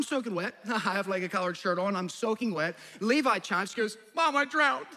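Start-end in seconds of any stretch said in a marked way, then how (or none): 4.1-4.9 put-on toddler voice.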